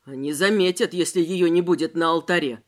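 low, concerned voice; worried. Acted. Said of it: "озабоченно проговорил Воронов".

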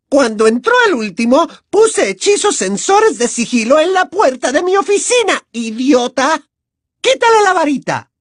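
a prim tyrant who enjoys cruelty; sickly-sweet cruel teacher. Acted. Umbridge berates Harry.

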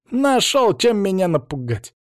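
put-on high, bravado-filled kid; scoffing boy. A narrator's reading. Boy unafraid of the man's warning, high bravado.

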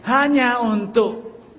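mock sweet sales pitch. Mocking seller's sweet sales pitch.

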